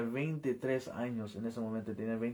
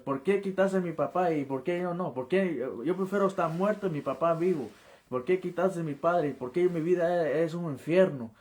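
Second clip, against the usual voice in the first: raised, anguished pleading; retold yelling at God; 5 semitones higher, 4 dB louder than usual. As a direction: demand answers from God, pitch up, reliving it. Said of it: "comencé a gritarle a Dios así".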